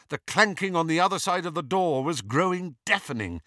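ordinary delivery

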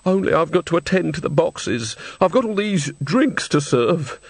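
high, plaintive excuse; fretful old woman. A narrator's reading. Old woman objects to Granny about her duties, fretful.

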